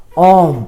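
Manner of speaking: ओम with the vowel stretched long in the middle; drawn-out Om. Shown as a demonstration. Teacher stretches ओम to show a pluta vowel, drawn out. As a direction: pull ओम out like a chant. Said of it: "बीच में थोड़ा सा हमको खींचना पड़ता है".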